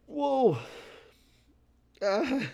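sudden high startled whoa. Real, not acted. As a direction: jump at something unexpected. Sudden startle mid-podcast.

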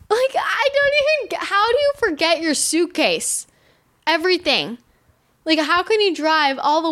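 high-pitched, exasperated disbelief; incredulous. Real, not acted. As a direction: pitch it way up, baffled by her blunder. Incredulous at the forgotten suitcase.

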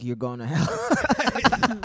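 slow, loud, high doom pronouncement; hammed-up hellfire warning; mock dire warning. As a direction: drag it out like a grim prophecy. Family superstition, mock warning.

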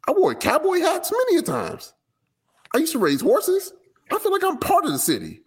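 high-pitched, indignant yelling. Acted. Reading out a rapper's rant at Dallas ticket buyers, high-pitched yelling. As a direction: plead and yell way up high. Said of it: "yelling at people is the way".

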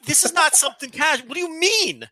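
high-pitched disbelieving objection; incredulous protest. Retold or imitated. Friend reacting to a horn in the yard, incredulous. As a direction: retell it with exasperated, rising disbelief. Acting out disbelief at casual answer.

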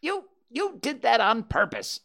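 high, indignant stammered start; sputtering. A narrator's reading. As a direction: accuse while recovering from a mouthful. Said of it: "sputtered Piglet".

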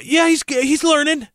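high, chirpy imitation of a baseball scout; lightly hammed-up insider voice; mock scout exchange. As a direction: play the upbeat scout excusing a bad catcher. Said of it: "That's how they say it".

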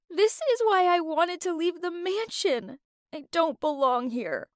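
a touch of crying through the words; tearful. A narrator's reading. Lou to housemates after betrayal, mildly tearful. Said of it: "I kept crying as I said".

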